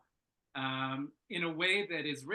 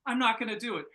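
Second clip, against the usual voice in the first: higher, louder flat refusal; playing the other character refusing, higher and louder; stubborn refusal; 6 semitones higher, 5 dB louder, faster than usual. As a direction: dig your heels in, mock defiant. Other character refuses, acted quarrel.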